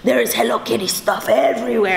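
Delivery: imitating an awed Spanish-accented dad; Spanish-accented father marvelling; dad's Spanish accent. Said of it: "when I do the accent".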